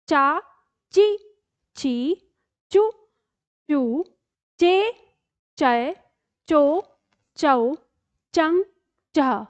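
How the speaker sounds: each syllable चा, ची, चु, चू, चे spoken briskly and separately; crisp recitation of every च syllable for students to repeat; barakhadi drill. Shown as a demonstration. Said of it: "मेरे साथ-साथ दोहराते रहिएगा". (च की बारहखड़ी साफ़ उच्चारण)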